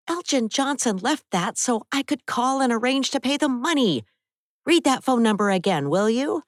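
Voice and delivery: a worried friend scolding a little; Abby's voice. Acted. Abby voiced in story.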